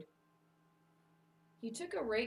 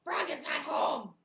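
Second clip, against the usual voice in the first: high, shouted character voice; gleeful Toad; 8 semitones higher, 7 dB louder than usual. Acted. Toad looks in Frog's window, shouting with glee. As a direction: call it out as a delighted Toad.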